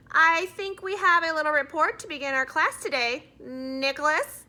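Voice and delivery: a strict schoolteacher putting him on the spot; teacher calling a pupil up to report; stern teacher. Acted. Mrs Granger calls on Nick.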